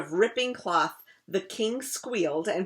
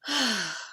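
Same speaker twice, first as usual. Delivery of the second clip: weary sigh; 3 semitones higher than usual. Acted. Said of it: "The crocodile sighed".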